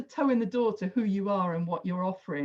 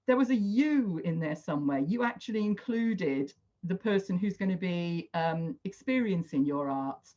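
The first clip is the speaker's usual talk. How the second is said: normal talking voice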